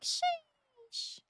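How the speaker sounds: high-pitched impressed sheesh. Retold or imitated. Retelling seeing a ninety-five cent sale, high sheesh. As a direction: squeak it, amazed at the price. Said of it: "I was like".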